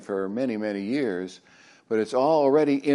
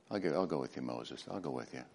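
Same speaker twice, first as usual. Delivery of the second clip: much softer, low intimate promise; hushed promise; 9 semitones lower, 14 dB quieter, faster than usual. Acted. God reassuring Moses, hushed and tender. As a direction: lean in and barely say it.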